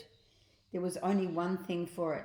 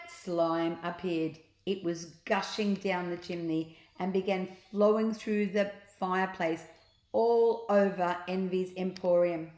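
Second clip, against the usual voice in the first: ordinary delivery, nothing marked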